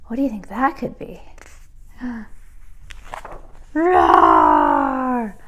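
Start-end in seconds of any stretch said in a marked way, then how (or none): 2.0-3.7 breathless frightened gasp. A narrator's reading. Narrator gasping before the dragon appears. 3.7-5.4 deep loud roaring; dragon roar. A narrator's reading. Dragon bursting out at the witch, a deep roar.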